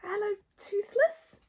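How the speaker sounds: high, slow, careful greeting; slow and high-pitched; Hiccup speaking Dragonese. A narrator's reading. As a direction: try out a careful foreign-tongue greeting. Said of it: "what he hoped was a good accent in Dragonese".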